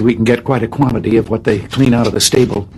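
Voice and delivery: normal talking voice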